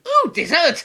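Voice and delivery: high, loud delighted squeal; sudden excitement. Retold or imitated. Vlogger escaping a boring chat, loud high squeal. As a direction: squeal like a kid spotting pudding. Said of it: "all of a sudden".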